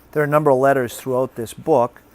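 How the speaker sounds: ordinary delivery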